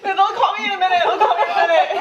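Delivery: high laughing sing-song; giggling wishful self-assurance; laughing mock hope. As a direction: laugh through it, sure they'll call. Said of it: "They calling you?".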